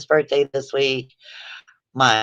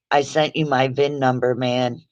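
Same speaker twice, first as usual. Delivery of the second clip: usual speaking voice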